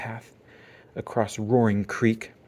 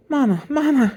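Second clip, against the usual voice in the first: put-on high, loud child's shout for Mama; 7 dB louder than usual. Upset cub calling her mother, high and loud. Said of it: "she shouted".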